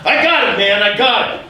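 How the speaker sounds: loud, high-pitched exasperated retort; irritated outburst. Retold or imitated. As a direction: snap loudly at the would-be helper. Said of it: "calm down".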